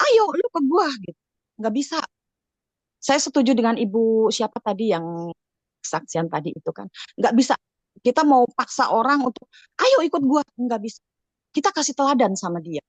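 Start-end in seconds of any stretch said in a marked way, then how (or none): 0.1-0.9 high-pitched imitation of a pushy recruiter; much higher, insistent mock command; mock pushy urging. Mimics pushing someone to follow. 9.8-10.4 higher-pitched pretend command; insistent mock coaxing, pitched up; mock pushy urging. Mimics forcing someone to follow.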